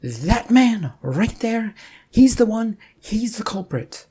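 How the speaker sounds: accusing shout. Acted. Boss accuses Rufus to police.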